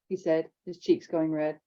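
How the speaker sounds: usual speaking voice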